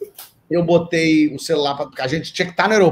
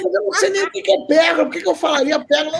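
high, fast retold protest; protesto exasperado; 11 semitones higher, faster than usual. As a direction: protest your innocence in a quick high voice. Husband protesting to hysterical wife. (protesto agudo e apressado, encenado)

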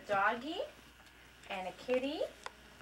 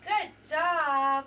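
loud, bright cheer; excited praise; 5 semitones higher, 11 dB louder than usual. Real, not acted. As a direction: cheer the little one on. Praising child's success.

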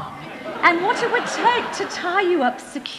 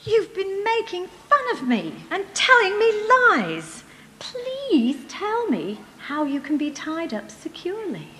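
high-pitched, whiny complaint; wheedling reproach; 7 semitones higher than usual. Acted. Delilah reproaches Samson, wheedles again.

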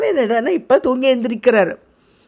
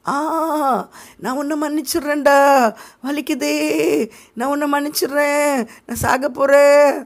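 high, hammed-up dying moan; mock dying voice; 6 semitones higher than usual. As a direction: forgive all as if on a deathbed. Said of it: "ஒரு சாகுற ஆள் என்ன சொல்லுவாங்களோ அந்த மாதிரி". (சாகப்போகும் ஆள் போல் நடிக்கும் முனகல்)

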